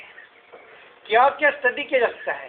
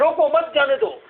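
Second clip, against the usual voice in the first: higher-pitched, mildly heated demo; mock quarrel; 5 semitones higher than usual. Shown as a demonstration. Teacher acts out someone in a fight saying let it go, mildly heated. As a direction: urge it as if arguing, pitch up. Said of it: "आप लड़ रहे हैं".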